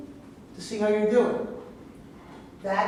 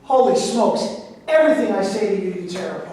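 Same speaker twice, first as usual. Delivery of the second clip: loud, fed-up protest; exasperated outburst; 8 dB louder than usual. Acted. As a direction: throw up your hands at her.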